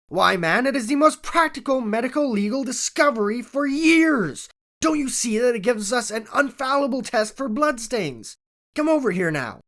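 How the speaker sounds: eager scientist urging his new acquaintance; eager, rising excitement. A narrator's reading. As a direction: sweep him along with enthusiasm. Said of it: "in his eagerness".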